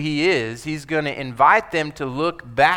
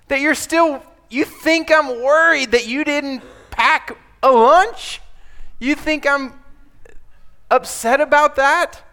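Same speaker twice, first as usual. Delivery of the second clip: much higher and louder, disbelieving; Jesus scolding the disciples, incredulous; incredulous rebuke; 9 semitones higher, 4 dB louder than usual. Acted. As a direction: throw it up in disbelief.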